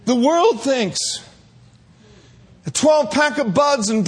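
fiery pulpit climax; preaching at full force. Acted. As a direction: build the sermon to a shouting peak.

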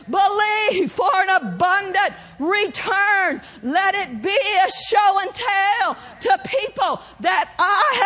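ringing, drawn-out sermon delivery; full-force preaching. Real, not acted. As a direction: proclaim it to the congregation with mounting fervour.

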